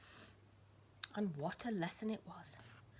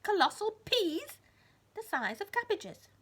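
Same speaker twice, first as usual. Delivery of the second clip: high, loud and slow; excited; 12 semitones higher, 10 dB louder than usual. A narrator's reading. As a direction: stretch it out in wonder. Storyteller emphasis on peas.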